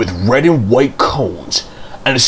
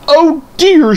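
high, loud dismayed groan; 5 dB louder than usual. Acted. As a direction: groan at the cheer you think you missed. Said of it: "she groaned".

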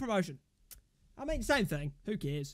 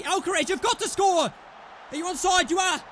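louder, insistent match call; tense, raised excited holler; urgent shout; 2 semitones higher, 5 dB louder than usual. Real, not acted. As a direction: shout the striker on. Urging player to score.

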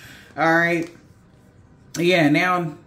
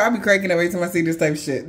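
ordinary delivery

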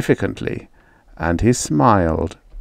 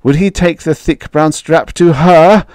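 usual speaking voice

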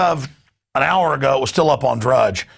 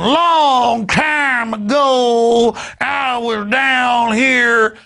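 hammed-up Kentucky drawl, stretched out; mocking a fake Southern accent; 10 semitones higher, 3 dB louder, much slower than usual. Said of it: "doing a redneck imitation".

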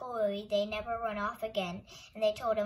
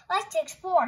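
high, loud adventurous cry; shouted; 7 semitones higher, 10 dB louder than usual. A narrator's reading. Henry waving his stick to explore, shouting. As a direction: call out eagerly to set off. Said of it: "he shouted, waving his stick".

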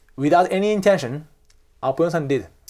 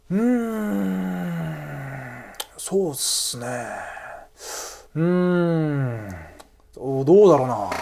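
mild reluctance; 3 semitones lower, 4 dB quieter than usual. Shown as a demonstration. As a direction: stall doubtfully, stretching each sound. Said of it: "If I mildly, like, uh, suggest".

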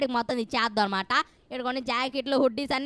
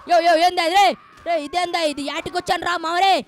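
loud, high startled shouting; alarmed outcry; 6 semitones higher, 7 dB louder than usual. Real, not acted. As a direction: cry out in sudden alarm. Startled by surprise in game. (కంగారుగా గట్టిగా కేకలు)

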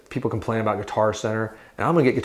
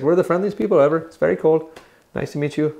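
mock Northern European accent; 5 semitones higher than usual. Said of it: "Every time I meet a Northern European".

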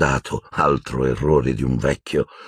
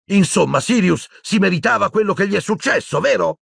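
much higher, sarcastic accusing yell; shouting. A narrator's reading. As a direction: throw the question like an accusation. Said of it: "Urlò Harry".

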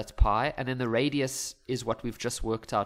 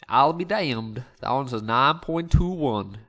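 louder, hammed-up cowboy accent; mock cowboy accent; 6 dB louder, slower than usual. Teacher jokingly announcing the calculator's answer, mock cowboy accent. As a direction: have another go at a bad cowboy twang. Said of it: "it wasn't good the first time".

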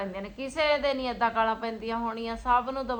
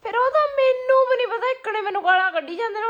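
high, loud exasperated outburst; exasperated complaint; 11 semitones higher, 9 dB louder than usual. Real, not acted. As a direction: throw up your hands and protest loudly. Wife complains loudly to camera.

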